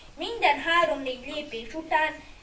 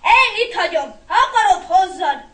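loud, high outburst; exasperated boy blowing up; angry snap; 4 semitones higher, 9 dB louder than usual. A narrator's reading. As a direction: snap at your little brother, fed up. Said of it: "Jóska felcsattant".

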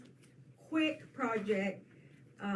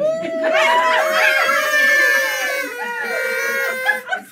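loud burst of laughter; 17 dB louder than usual. Real, not acted. Burst of laughter at sewing machine.

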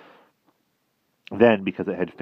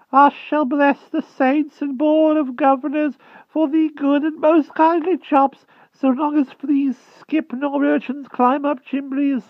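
very high, slow rustic country voice; a frightened old hedgehog begging humbly; timid hedgehog voice; 7 dB louder than usual. A narrator's reading. Hedgehog begging the Wart for mercy, high timid rustic voice.

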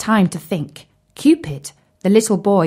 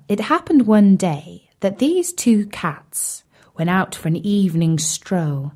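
usual speaking voice

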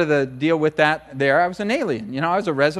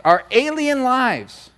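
alien lives drawn out high and slow; emphatic; 8 semitones higher, 4 dB louder, much slower than usual. Real, not acted. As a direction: lean hard and slow on our alien lives. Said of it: "preacher stresses phrase".

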